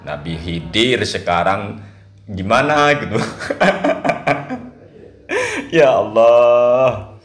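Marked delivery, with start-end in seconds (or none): 3.2-4.7 a real high-pitched airy giggle. Real, not acted. Host laughing at Nabi Khidir in politics, high giggle. 5.7-7.2 slow, louder, stretched Ya Allah; loud drawn-out exclamation. Real, not acted. Host reacting to the viral clip, loud stretched disbelief.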